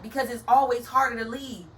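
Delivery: ordinary delivery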